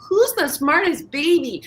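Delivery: put-on high sing-song baby talk to a dog; dog-directed baby talk. Shown as a demonstration. Talking to a dog, high baby-talk praise. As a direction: coo it like praising a puppy. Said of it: "when we speak to a dog".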